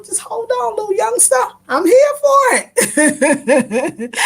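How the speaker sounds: high, slow, giggling encouragement; laughing tease. Retold or imitated. To the cab driver, teasing and laughing. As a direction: tease him through laughter.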